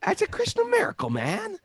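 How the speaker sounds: excited exclamation. Real, not acted.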